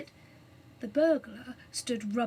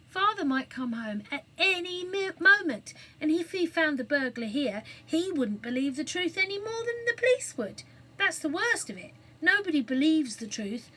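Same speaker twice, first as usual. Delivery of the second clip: put-on higher, anxious boy's voice; worried boy; 6 semitones higher, 4 dB louder than usual. A narrator's reading. Cyril fearing their father's return, worried higher voice. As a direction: fret that nobody will believe.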